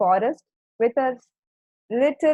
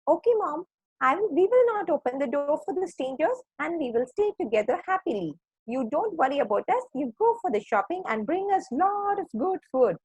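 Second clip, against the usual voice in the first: higher-pitched little lamb voice; 7 semitones higher than usual. A narrator's reading. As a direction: reassure mother sheep in a high, obedient little voice. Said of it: "said the little lambs".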